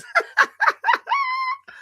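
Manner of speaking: high squealing laugh; real laugh. Real, not acted. Host resolved to ask hard questions, squealing with laughter. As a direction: crack up into a high trailing squeal.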